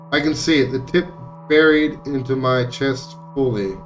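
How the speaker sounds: normal talking voice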